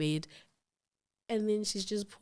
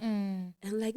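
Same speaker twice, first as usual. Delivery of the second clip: usual speaking voice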